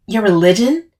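rising, disbelieving echo; incredulous. A narrator's reading. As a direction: throw it back as an unbelievable question. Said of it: "I repeated incredulously".

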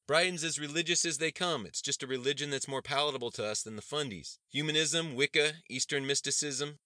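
high, loud, fired-up argument; bellowing. A narrator's reading. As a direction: hold forth to the gathering crowd. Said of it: "Elijah bellowed".

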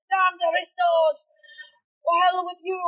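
high, cursing shout as an angry worker; angry workers yelling. Acted. Workers cursing the aristocrats after the carriage, shouting. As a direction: yell curses after the carriage. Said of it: "other workers are yelling".

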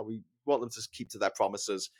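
ordinary talk, nothing marked

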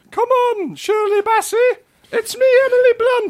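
very high falsetto celebrity impression; Emily Blunt. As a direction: squeal it out in a silly high voice. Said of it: "How do you impersonate Emily Blunt?".

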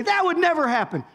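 high-pitched protest; imagined objection. Pretend. Preacher voices a hypothetical objector in the congregation, high protest. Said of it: "anybody wanna say".

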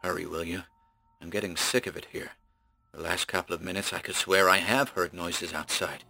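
quiet, uneasy urging; hushed and nervy, wanting out; a little ragged. Acted. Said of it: "a little ragged".

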